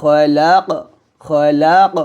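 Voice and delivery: খলাক ended with an echoing bounced ক; qalqala on qaf. Shown as a demonstration. Teacher demonstrating qalqala on the stop, echoing final ক. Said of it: "এরকমভাবে".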